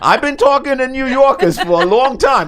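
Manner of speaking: a playful put-on Bronx accent; mock Bronx accent. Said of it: "that was my best Bronx accent".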